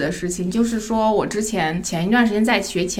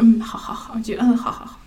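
whispered, fading okays; meek whispered agreement. Retold or imitated. She to her partner, whispered meek okays. Meekly agreeing without a word.

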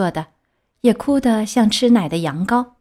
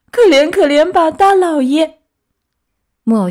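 put-on high, loud begging puppet boy; pleading; 8 semitones higher, 7 dB louder than usual. A narrator's reading. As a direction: beg for mercy in a high-pitched wail. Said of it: "开始哀求他说". (尖着嗓子哀求)

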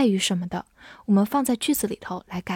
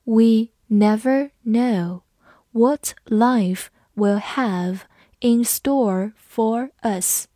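slow, word by word for learners; teacher's slow dictation pace; slow read; much slower than usual. Shown as a demonstration. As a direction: read it out slowly so students can follow. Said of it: "我们慢读一遍".

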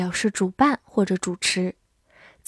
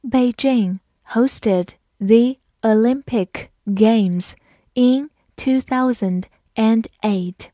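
slow, careful reading of each word; slow read-through; slower than usual. Shown as a demonstration. Teacher reading the sentence slowly for students, word by word. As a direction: read it slowly for the learners to follow. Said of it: "好，慢慢来读".

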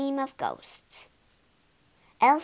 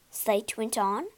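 plain reading voice

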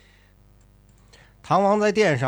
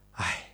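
a soft, weary sigh; 10 dB quieter than usual. Acted. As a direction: let out a quiet heavy sigh. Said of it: "叹一口气". (轻轻叹一口气)

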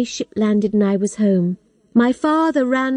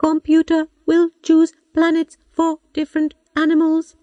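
computer voice; 7 semitones higher than usual. A narrator's reading. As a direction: speak like a patient old robot. Robot computer speaks.

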